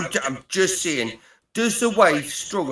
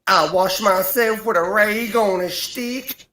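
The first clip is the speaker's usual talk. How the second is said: put-on American accent; 6 semitones higher than usual. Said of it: "Can you do an American accent?".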